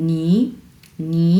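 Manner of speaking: "নি" in a rising second tone. Shown as a demonstration. Teacher models tone two for learners, "নি" rising. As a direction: lift "নি" upward as tone two. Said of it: "আমরা কিভাবে উচ্চারণ করব দুই number tone?".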